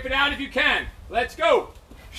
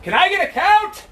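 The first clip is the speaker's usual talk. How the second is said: calling out; 8 semitones higher, 3 dB louder than usual. Real, not acted. Coach calls for a count.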